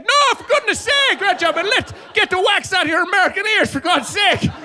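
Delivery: high, exasperated mock-British telling-off; mocking British scold. Exasperated man scolding the American, high mock-British voice. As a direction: squeal it like a fussy Brit fed up with an American. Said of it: "And he's like".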